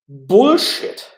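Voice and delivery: sudden loud high burst; exploding on bullshit. Real, not acted. Streamer ranting about Trump blocking the bill, loud outburst. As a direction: spit out the word in disgust.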